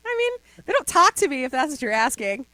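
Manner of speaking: high, loud, giddy protest; squealing reply. Retold or imitated. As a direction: squeal it out in a rush. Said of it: "I was like".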